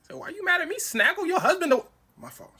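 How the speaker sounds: high loud indignant protest; defensive outburst. Retold or imitated. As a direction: snap back, offended. Defensive reply to the angry wife.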